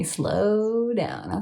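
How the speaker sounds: "Slow" stretched out long, then "down"; drawn-out slow down. Retold or imitated. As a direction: draw out the word "Slow" in "Slow down". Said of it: "Okay, I'm slowing down".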